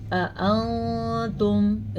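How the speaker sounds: أَأَنْتُمْ with a hidden nasal noon; nasal ghunna; ikhfa nasal. Shown as a demonstration. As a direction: hum the ن in أَأَنْتُمْ through the nose. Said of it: "اخفا کا قاعدہ ہو رہا ہے".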